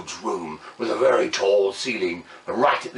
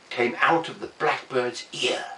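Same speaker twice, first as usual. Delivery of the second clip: ordinary delivery, nothing marked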